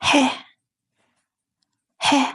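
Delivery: "he" as a short heh with breathy H; Spanish G name. Shown as a demonstration. Teacher naming letter G in Spanish, short heh. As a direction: say "he" as heh, each one separate. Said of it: "it's pronounced as".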